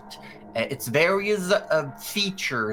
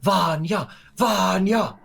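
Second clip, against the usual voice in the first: loud, raised chant of a name; higher, louder rallying calls; cheering crowd; 8 semitones higher, 7 dB louder, slower than usual. Acted. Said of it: "there's people cheering".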